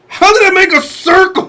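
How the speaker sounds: high, hammed-up baffled outburst; mock exasperated cry. Cell failing to make a circle, mock exasperated cry.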